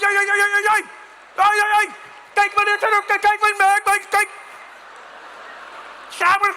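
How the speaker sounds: high, loud, rapid-fire "ai, ai, ai"; angry bike bell. Acted. Cyclist coming at full speed, angry mock-Dutch tirade. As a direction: scold in frantic mock-Dutch gibberish. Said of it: "I've never heard a bell sound angry".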